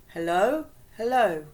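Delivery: loud, questioning call of hello. Acted. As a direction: call out to wake someone up. Woman calling to dazed girl.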